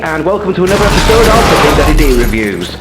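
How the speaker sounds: high, bright hammed-up host intro; pumped-up review show opener. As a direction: announce yourself like a TV host.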